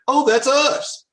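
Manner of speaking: high-pitched mock surprise; pleased discovery. Clicking through the blog and finding themselves, pleasantly surprised. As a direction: act out a happy little find. Acting out finding their own training.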